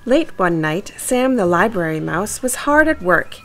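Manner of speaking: ordinary delivery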